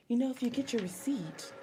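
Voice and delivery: soft mock-sultry murmur; hushed, imitating a seductive clerk. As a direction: say it softly, as if into someone's ear. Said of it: "like, sultry".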